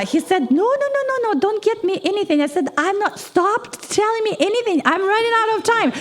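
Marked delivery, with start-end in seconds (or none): 0.5-2.4 much higher, fast no no no; mock protest. Young cashier refusing a gift. 2.8-5.9 higher and fast, hurried; retold rushing. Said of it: "I'm rushing".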